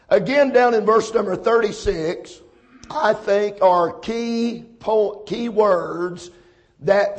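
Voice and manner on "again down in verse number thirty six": ordinary talk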